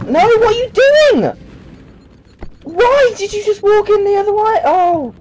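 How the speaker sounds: very high frantic shriek; panicking gamer. Real, not acted. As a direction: squeal in panic at the screen. Character walks the wrong way.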